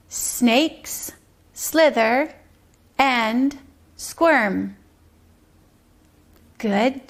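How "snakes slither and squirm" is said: "Snakes slither and squirm" with a pause after each word; one slow beat per word in "Snakes slither and squirm"; word-by-word tapping. Shown as a demonstration. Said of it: "One tap for each word".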